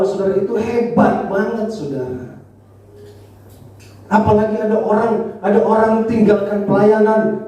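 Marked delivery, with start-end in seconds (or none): none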